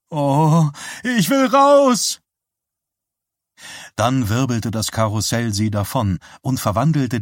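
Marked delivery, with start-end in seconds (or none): 0.1-2.2 high, loud, drawn-out shriek on the ride. A narrator's reading. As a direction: scream it out, half scared, half thrilled. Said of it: "sie kreischte und lachte".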